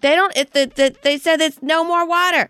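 high, exasperated protest. Retold or imitated. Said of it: "I'm like".

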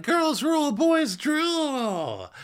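put-on very high, sing-song girlish chant; hammed-up high cheerleader chant; mock cheer. Drunk girls cheering together after ripping up a note, mock cheer. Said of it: "And they all cheer. They're like".

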